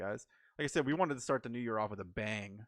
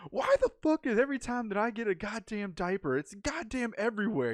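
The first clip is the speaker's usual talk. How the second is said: much higher, louder exasperated rant; furious rant; 10 semitones higher, 3 dB louder than usual. Retold or imitated. A friend imitating dad Jeff raging at a diaper, furious rant. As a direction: vent like a dad sick of blowouts. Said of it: "Jeff was just getting furious".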